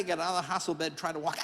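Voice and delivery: ordinary talk, nothing marked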